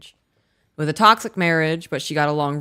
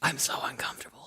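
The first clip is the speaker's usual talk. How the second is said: whispering; 3 semitones lower, 11 dB quieter, faster than usual. Real, not acted. Awkward moment, mom yelling nearby.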